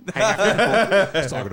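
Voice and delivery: laughing. Real, not acted. Laughing reply to bed story.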